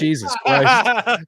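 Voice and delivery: laughing. Real, not acted. Laughing at the clip.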